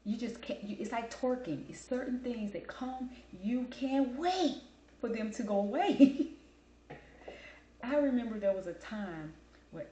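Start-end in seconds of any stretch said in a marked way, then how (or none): 3.4-6.3 exasperated. Real, not acted. Exasperated rant about passing trends.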